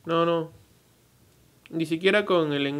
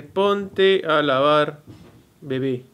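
"Ponte a lavar, bebé" spelled out very slowly, word by word; "Ponte a lavar" drawn out word by word, "bebé" at normal speed; slow careful reading; 5 dB louder, much slower than usual. Shown as a demonstration. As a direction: read the caption slowly, word by word. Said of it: "Acá dice".

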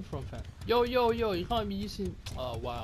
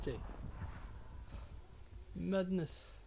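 ordinary delivery

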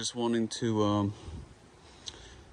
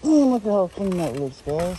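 excited; 8 semitones higher, 6 dB louder than usual. Real, not acted. Proud of the clean roof.

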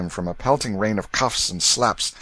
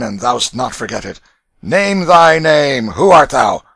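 loud, raised voice of a domineering brute; bullying ruffian, jeering; 5 semitones higher, 10 dB louder than usual. Acted. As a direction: bellow orders like a coarse bully. Narrator voices ruffian Canty.

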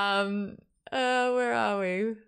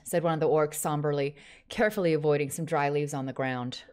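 American-accented audiobook reading; 2 semitones lower than usual. Shown as a demonstration. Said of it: "Read it in an American accent".

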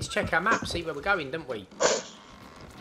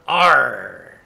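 louder pirate growl; loud pirate arr; 2 semitones lower, 13 dB louder than usual. Pretend. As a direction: bellow it like a pirate.

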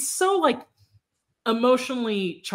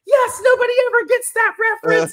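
much higher and louder, thrilled exclamation; delighted shout; 8 dB louder, faster than usual. Retold or imitated. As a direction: shout it like someone finally gets the joke. Man's delighted reply, retold.

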